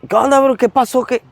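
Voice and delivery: surprised protest. Retold or imitated. Confronting his captors.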